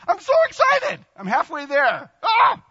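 very high and loud squeal of glee; giddy high-pitched delight; childlike excitement. Acted. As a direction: burst out like an excited kid. Preacher acting childlike glee.

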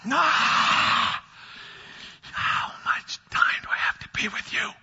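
breathy weary whisper; exasperated whisper. Retold or imitated. Jesus voiced, exasperated whisper.

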